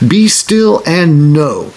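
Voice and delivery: slow emphatic be still. Shown as a demonstration. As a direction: draw out every word.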